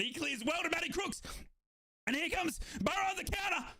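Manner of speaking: high, rising counter-attack call; excited play-by-play. Real, not acted. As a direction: lift the commentary high as they break. Commentary rises with counter-attack.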